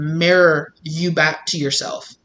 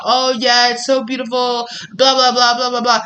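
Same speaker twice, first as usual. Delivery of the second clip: mock eager reply; 5 semitones higher than usual. Faked reply on a date.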